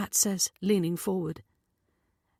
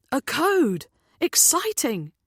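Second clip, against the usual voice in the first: put-on high, loud teenage enthusiasm; excited; 8 semitones higher, 4 dB louder than usual. A narrator's reading. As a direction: exclaim it with eager delight. Teenager thrilled by a code.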